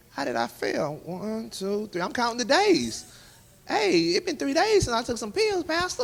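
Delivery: very high-pitched, incredulous pleading; disbelieving protest. Retold or imitated. Pleading with the pastor over a failed drug test, incredulous. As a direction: argue back in a high, baffled voice.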